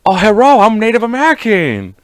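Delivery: high, loud comic voice; put-on character voice. Partner voices imagined mixed grandchild, comic put-on voice. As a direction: call it out in a hammed-up high voice.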